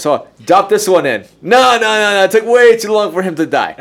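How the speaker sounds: excited shout. Pretend. Mock experimenter ordering more worms.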